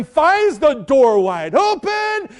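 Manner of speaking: ordinary delivery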